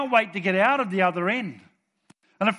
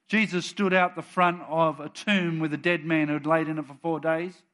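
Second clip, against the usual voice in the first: usual speaking voice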